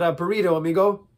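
loud, lively Mexican accent. Shown as a demonstration. Accent warm-up, playful Mexican accent. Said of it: "or Mexican".

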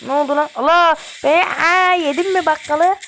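high, loud "Allah!" cry of shock; real startled outburst; shocked exclamation. Real, not acted. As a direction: caught off guard mid-fight. Gamer gets hit, exclaims.

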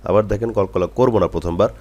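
usual speaking voice

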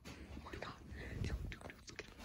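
hushed urgent whisper. Real, not acted. Hiding from the homeowner, whispering to friends. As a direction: whisper so he can't hear.

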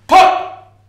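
loud, high imitated gun pop; loud mouth-made gun sound; mock gunshot pop. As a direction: spit out a gunshot noise. Imagined gunshots.

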